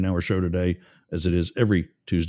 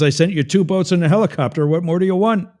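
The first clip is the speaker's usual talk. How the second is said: high, loud exasperated scolding; angry; 9 semitones higher, 6 dB louder than usual. Acted. God to the drowned man who refused every rescue, angry. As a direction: scold in acted, incredulous exasperation. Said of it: "tapping a toe quite angrily".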